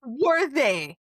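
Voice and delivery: loud, indignant demand; exasperated outrage. Real, not acted. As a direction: burst in, loudly incredulous. Outraged at absent friends.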